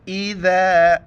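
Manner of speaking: slow, drawn-out melodic tilawat; Quran recitation. Acted. Teacher reciting the verse for students, slow melodic recitation. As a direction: chant each word long and ringing.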